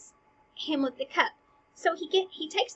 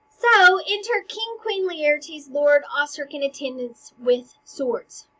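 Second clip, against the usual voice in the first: loud, slow stage-direction read, starting high; loud announcing; 3 semitones higher, 9 dB louder, slower than usual. Acted. Teacher announcing the royal entrance to class, loud announcing. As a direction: announce the entrance grandly, drawn out.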